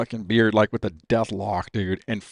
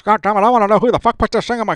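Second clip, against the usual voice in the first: fast, much higher and louder yell; furious demand; 11 semitones higher, 6 dB louder, faster than usual. Retold or imitated. Dad at the party door demanding who planted the bomb. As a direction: demand who did it at full volume. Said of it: "like this".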